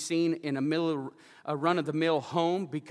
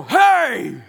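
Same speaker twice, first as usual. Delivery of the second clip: loud, high imitated yell; retold holler; 7 semitones higher, 11 dB louder than usual. Hard-of-hearing veteran startled by a lady in the bathroom, retold shout.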